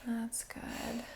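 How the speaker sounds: soft unvoiced whisper of praise. Real, not acted. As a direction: breathe the words out gently. Whispered praise to breathing client.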